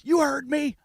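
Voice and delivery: imitating the mad cop writing a ticket; mock angry cop. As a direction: snap it like an irritated officer. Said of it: "He goes".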